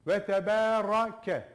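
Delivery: Ve tebarake with a heavy ra, slow and loud; thick ra. Shown as a demonstration. Teacher showing the thick ra in tebarake. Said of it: "Ra'yı kalın okuyacaksın".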